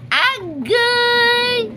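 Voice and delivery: sudden high yelp of surprise; startled agay. Real, not acted. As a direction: jump at something, squeal it out. Sudden cry at the kittens. (kalit nga taas nga agay)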